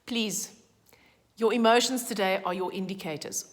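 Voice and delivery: ordinary talk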